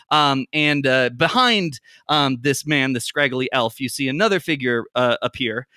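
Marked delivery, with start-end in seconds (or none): none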